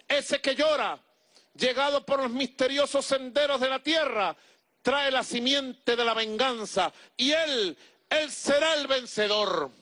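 high, soaring prophetic proclamation; dramatic exaltation. A narrator's reading. Shaman proclaiming to the tribe that the child will win, exalted. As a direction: proclaim the people's survival with fervor. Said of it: "con dramática exaltación".

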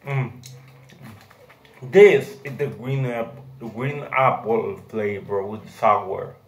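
talking while chewing; chewy candy in mouth; mouth full of gummy. Real, not acted. Seen 1: holds gummy near face; lips and cheeks show chewing while talking.

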